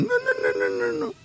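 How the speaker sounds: put-on very high, squeaky old lady saying no; squeaky grandma refusal. Grandma refuses to move in with family, high squeaky.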